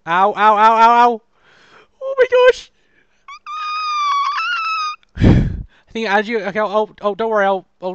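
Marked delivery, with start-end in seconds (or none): 0.1-1.2 yelling ow. Real, not acted. Hurt in the game fight. 2.0-2.7 high, loud startled shriek; shrieking oh my gosh. Real, not acted. Shock during the fight. 3.3-5.1 a sudden high-pitched scream. Real, not acted. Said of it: "What was that scream?".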